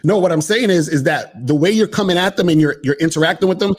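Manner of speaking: usual speaking voice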